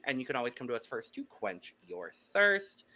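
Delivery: ordinary talk, nothing marked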